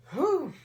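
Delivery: sharp high exhale; whew. Real, not acted. Exhaling after the sour bite.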